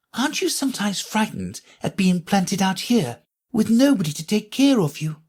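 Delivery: put-on high, light girl's voice asking; young Alice. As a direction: ask like a curious young girl.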